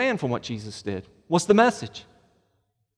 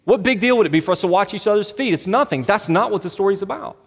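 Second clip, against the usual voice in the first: ordinary delivery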